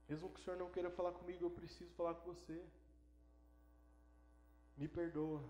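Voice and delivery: soft, subdued pleading; hushed, understated begging; retold humble plea. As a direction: ask forgiveness quietly and humbly. Son pleads for forgiveness.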